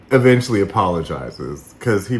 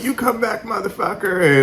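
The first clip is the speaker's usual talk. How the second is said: high-pitched imitation of a man spoiling for a fight; mimicking his challenge; 10 semitones higher than usual. Host imitating the man back on Zoom ready to fight, mock challenge. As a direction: retell his call-out in a raised pitch. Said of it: "and in his, you know, way of saying it".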